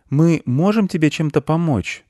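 put-on high boy's question; high-pitched young boy asking gently; little boy. A narrator's reading. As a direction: ask in a high child voice. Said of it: "уточнил мальчик".

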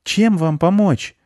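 put-on high, slow childlike voice; children asking. A narrator's reading. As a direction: offer help like keen kids, drawn out. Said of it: "спросили дети".

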